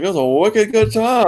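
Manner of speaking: high, slow mock Boston accent; imitating a Boston accent, high and drawn out. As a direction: ham up a Boston woman's hard A. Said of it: "hit a hard A".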